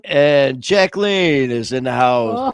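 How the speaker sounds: loud, high, drawn-out welcome; hyped announcement. Real, not acted. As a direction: announce the arrival with slow fanfare. Host announces an arrival.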